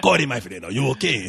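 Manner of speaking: put-on low, loud foreign-accented concern; Rudy. Janitor greets waking guest, foreign accent. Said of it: "guys with accents".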